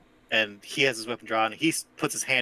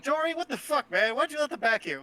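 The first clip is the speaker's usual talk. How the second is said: angry scolding; 11 semitones higher than usual. Acted. GM voices NPC scolding bartender.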